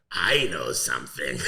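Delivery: low, slow, drawn-out announcement; mock mysterious clown. Clown rolls out with the best line, low mock mystery. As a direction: intone it low as a hammy secret.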